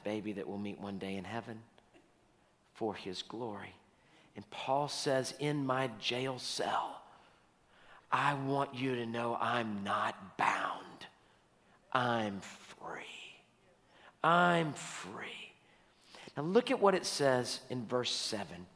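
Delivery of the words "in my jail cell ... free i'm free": slow, soft and drawn out, nearly whispered; hushed declaration. Acted. Voicing Paul in his jail cell, hushed and slow. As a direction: let each word hang, low and hushed.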